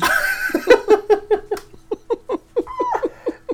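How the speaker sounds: squealing high laughter. Real, not acted. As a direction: shriek with laughter. Laughing hard at a joke.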